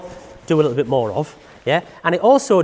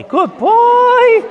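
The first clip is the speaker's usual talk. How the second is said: far higher, louder praise; high dog praise; 8 dB louder than usual. Real, not acted. Trainer rewarding her dog for the string, high excited praise.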